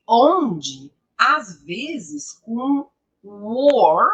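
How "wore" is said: WORE drawn out with a rounded closed vowel. Shown as a demonstration. Teacher warning students about confusable words, closed WORE.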